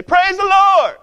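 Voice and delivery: high, loud joyful yell of praise. Retold or imitated. Old lady yelling praise from her porch, joyful shout. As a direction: call it out from the porch, high and bright.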